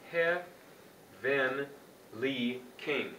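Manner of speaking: "He, Ven, Li, King" broken into separate syllables with pauses. Shown as a demonstration. Chant teacher splitting Heavenly King for pupils, syllable by syllable. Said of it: "recognize what's happening in every syllable".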